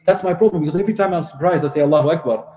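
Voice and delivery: normal talking voice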